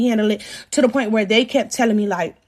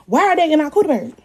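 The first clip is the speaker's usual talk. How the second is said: usual speaking voice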